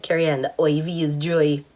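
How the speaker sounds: imitated Bajan accent, IV as "oy-vee"; Bajan nurse. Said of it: "this amazing Bajan nurse that I work with".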